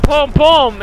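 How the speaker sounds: quick mouthed pum pum gun noises; mock gunshots. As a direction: make the shots with your mouth, playful. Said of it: "I would be like".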